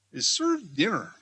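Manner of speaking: usual speaking voice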